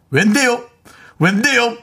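high, mock-whiny talking back; husband's sulky comeback. Husband sulkily talking back to nagging wife. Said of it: "제가 잔소리한다 싶으면".

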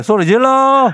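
rally the caller with a cheer; shout. Acted. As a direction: yell it out loud. Host urges caller to shout.

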